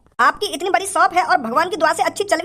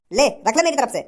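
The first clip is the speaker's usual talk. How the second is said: normal talking voice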